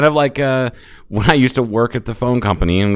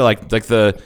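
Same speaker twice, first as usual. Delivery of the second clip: ordinary talk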